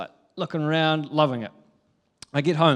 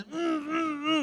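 much higher, pleading hum; whining toddler; 13 semitones higher, 4 dB quieter, slower than usual. Retold or imitated. Toddler pointing at dad's pocket, whining hum. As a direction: whine for the phone without words.

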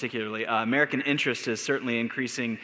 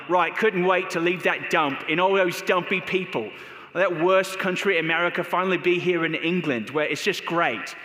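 hammed-up English accent, pitched higher and slowed near the end; mock English accent; 5 semitones higher than usual. Imagined American player gloating in England, mock English accent. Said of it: "started speaking with an English accent on top of it".

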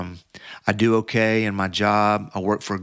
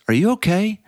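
much higher, louder caring question; concerned dude; 11 semitones higher, 4 dB louder than usual. Retold or imitated. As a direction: check on him like a worried friend. Guy asks the exhausted runner.

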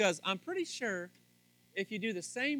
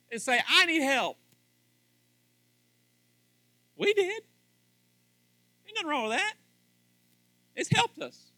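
loud, high preaching; 10 semitones higher, 7 dB louder than usual. Real, not acted. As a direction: build to full-force preaching. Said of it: "I'm getting a little preachy there".

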